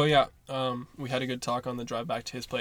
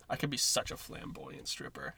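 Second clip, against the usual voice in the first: whispered; 11 semitones lower, 7 dB quieter than usual. Real, not acted. Whispered joke.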